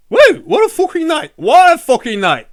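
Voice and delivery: very high, loud cheering whoop; excited whoop. Real, not acted. Streamer wrapping up a great stream, cheering loudly. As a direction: celebrate the night at full volume.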